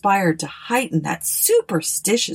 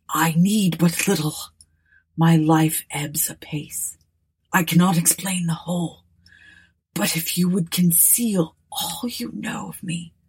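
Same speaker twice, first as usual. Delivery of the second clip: dying words. Acted. Ruthven's dying request.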